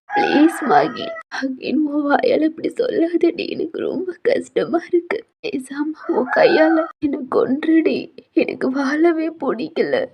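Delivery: high, slow tearful pleading; crying plea. Acted. Heroine begs friend, crying. (அழுதுகொண்டே கெஞ்சல்)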